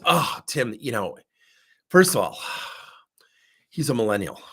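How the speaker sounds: imitated exasperation with a heavy sigh; exasperated. Client venting to her coach about a young employee, exasperated. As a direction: huff it out, fed up, sighing between phrases. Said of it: "This woman was just exasperated".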